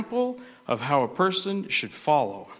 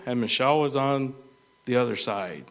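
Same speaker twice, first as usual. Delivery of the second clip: ordinary delivery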